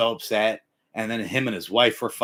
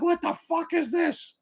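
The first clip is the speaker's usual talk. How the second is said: high-pitched, slow bewilderment; stunned disbelief; slower than usual. Acted. Fourteen-year-old facing space-age tech, high and stunned. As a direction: play a baffled teenager in a high voice. Acts out stunned younger self.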